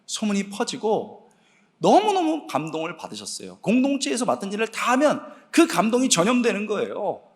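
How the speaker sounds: ordinary delivery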